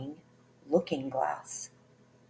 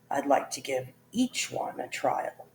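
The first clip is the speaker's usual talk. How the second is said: a hint of a sly, lower king voice; shifty king; 4 semitones lower than usual. A narrator's reading. The king to his court, weighing the girls, sly and smiling. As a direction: say it with a shifty smile. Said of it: "said with a shifty smile".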